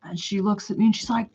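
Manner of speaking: normal talking voice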